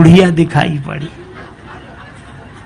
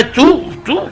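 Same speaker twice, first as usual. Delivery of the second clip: high mock yelp; 10 semitones higher, slower than usual. Teeth cut, cry of pain.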